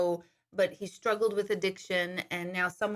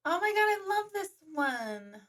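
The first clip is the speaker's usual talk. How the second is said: delighted; 10 semitones higher than usual. Real, not acted. Excited over a viewer comment.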